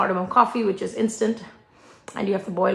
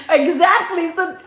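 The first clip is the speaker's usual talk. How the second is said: ordinary talk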